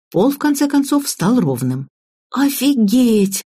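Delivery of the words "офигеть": put-on slow, higher girl's astonishment; stunned muttering. A narrator's reading. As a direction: draw it out in mild amazement. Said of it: "пробормотала Ира". (протяжно, ошеломлённо)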